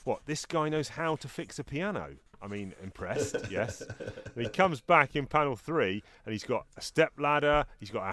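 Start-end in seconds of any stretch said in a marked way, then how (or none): 0.0-2.2 mock surprise. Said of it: "immediately I'm thinking".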